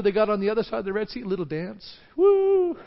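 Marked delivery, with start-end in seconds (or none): 2.2-2.8 high, loud joyful whoop. Retold or imitated. Israelites celebrating on the far shore with a whoop. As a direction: cheer like a dancing crowd. Celebrating after the Red Sea.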